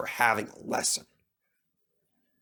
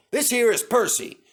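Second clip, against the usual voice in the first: higher, louder and brisk introduction; cheerful boss engine-master; 10 semitones higher, 5 dB louder than usual. Acted. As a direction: present the new engine quickly. Sir Topham Hatt voiced.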